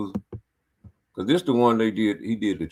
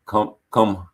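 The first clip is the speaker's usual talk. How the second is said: ordinary talk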